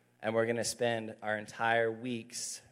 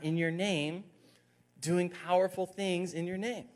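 high, petulant whining complaint; whiny voice; 9 semitones higher, 5 dB louder than usual. Acted. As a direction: whine it like an upset kid. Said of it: "I like to read that in a whiny voice".

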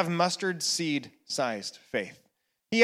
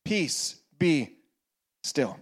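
slow words, fading lower on still; spaced soft echo; 6 semitones lower, 5 dB quieter, slower than usual. Acted. Pastor repeating the peace, soft and spaced. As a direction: echo the peace, each word alone.

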